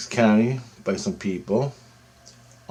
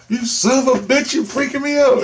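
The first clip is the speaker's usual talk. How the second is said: much higher, excited outburst at a buddy; freaked-out friend. Retold or imitated. Friend runs up to him, high and excited. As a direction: blurt it out all worked up.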